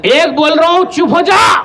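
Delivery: irritable officer giving a warning; hot-tempered colonel. Acted. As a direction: count out a warning, then command silence. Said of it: "colonel साहब बोले".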